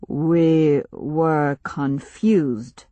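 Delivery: slow, grave stranger voice; slow solemn chief. Acted. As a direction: speak slowly like a village elder.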